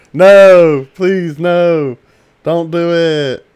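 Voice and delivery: loud, slow begging; mock pleading shout. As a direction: beg them to stop, drawn out. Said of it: "was that in character?".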